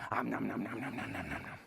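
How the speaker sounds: low playful om nom chomping; greedy munching sounds; munching noises. Pretend. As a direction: gobble it up like a hungry bear. Eating sounds for Big Bear.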